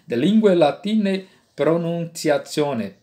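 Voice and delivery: "De lingue latine pronunciatione" in Italian church style, "lingue" as lingwe; slow Italian-style Latin, "latine" ending in e; ecclesiastical Latin. Shown as a demonstration. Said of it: "a pronúncia italiana, ou também chamada pronúncia eclesiástica".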